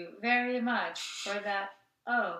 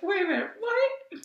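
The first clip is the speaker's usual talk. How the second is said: surprised; 11 semitones higher, 4 dB louder than usual. Retold or imitated. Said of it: "I was like".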